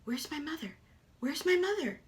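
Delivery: duckling calling for its mother; lost duckling. Acted. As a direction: cry out like a lost baby duck. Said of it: "you could hear it".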